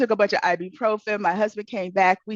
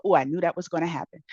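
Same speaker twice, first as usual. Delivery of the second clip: quick resigned aside; 5 semitones lower, 5 dB quieter, faster than usual. Retold or imitated. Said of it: "and then you go".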